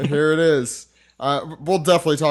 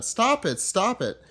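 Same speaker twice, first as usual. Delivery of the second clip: put-on crying child; 8 semitones higher, slower than usual. Young Pudding crying.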